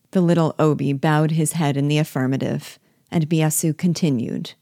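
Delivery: plain reading voice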